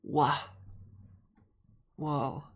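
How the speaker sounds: ordinary talk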